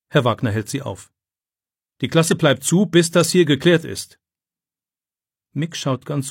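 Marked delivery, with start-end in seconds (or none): 2.0-4.2 stern command. A narrator's reading. Teacher stops two pupils.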